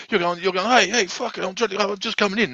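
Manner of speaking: squeaky innocent protest at the door; high mock pleading. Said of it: "And he's like".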